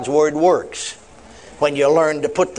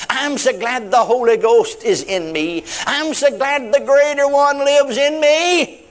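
slow, drawn-out joyful prayer, building higher; glad declaration; 6 semitones higher, slower than usual. Shown as a demonstration. Preacher showing a woman her morning prayer, glad and building. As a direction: build from a tender start to ringing gladness.

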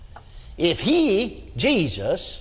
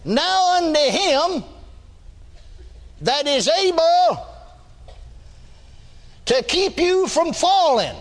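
preaching; 8 semitones higher than usual. Acted. Scripture intoned in sermon.

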